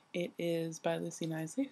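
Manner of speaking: usual speaking voice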